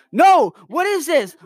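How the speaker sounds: high, loud cry of dismay; alarmed cry. Real, not acted. Annoyed by yet another pop-up, crying out high and loud. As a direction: yell at the screen in alarm.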